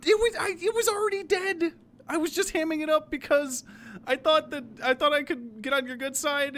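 loud, high frantic excuses; panicked babbling. Acted. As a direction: blurt it all out in a panic. Panicked excuses after being accused.

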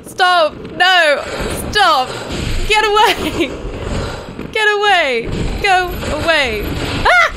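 high loud panicked yells; screaming at a monster closing in; frantic screaming. Real, not acted. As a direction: scream at it to back off. Fighting off enemy, screaming.

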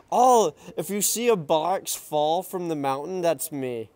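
usual speaking voice

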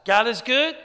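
loud rising cheer call. Real, not acted. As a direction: call it out to the crowd. What is the cheer that I teach you guys?.

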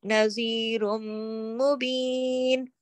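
"नज़ीरुम मुबीन" slowly, stopping on a long "been"; pausal recitation. Shown as a demonstration. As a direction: stretch "नज़ीरुम मुबीन" and rest on the final n. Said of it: "अगर रुकेंगे तो".

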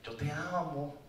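soft, gentle, fading to a whisper; tender reassurance. Pretend. God to the lost one, soft and tender. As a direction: comfort him quietly.

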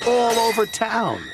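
heightened, emphatic storytelling; dramatic narration. A narrator's reading. Narrator tells how the scream broke windows, heightened drama. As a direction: build to a high finish.

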